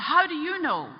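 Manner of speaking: high, loud burst of disbelief; startled question. Retold or imitated. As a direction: demand it in astonishment. Man startled by priest's knowledge.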